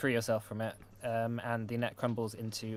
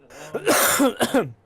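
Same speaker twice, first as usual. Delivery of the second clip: loud real cough; harsh, hacking cough; hefty cough; 10 semitones higher, 10 dB louder than usual. Real, not acted. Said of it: "That was a pretty hefty cough there".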